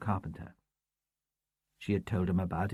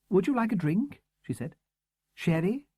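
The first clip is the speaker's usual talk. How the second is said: courteous hostess; 9 semitones higher than usual. A narrator's reading. Miss Hunt voiced, courteous hostess.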